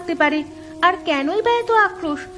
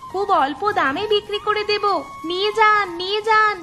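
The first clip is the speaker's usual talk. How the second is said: hawker's cry; 4 semitones higher than usual. Acted. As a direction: call out to passing buyers, pitched high. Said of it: "তোমরা চিৎকার করছিলে". (আম বিক্রেতার চড়া গলায় টানা হাঁক)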